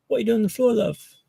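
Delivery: a casual British nurse asking kindly; lightly voiced British accent. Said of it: "that's a fantastic British accent you have there".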